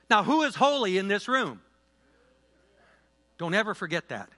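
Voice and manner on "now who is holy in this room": high, loud question to the room; rally the room again; loud preaching call. Real, not acted. Preacher calls to congregation again.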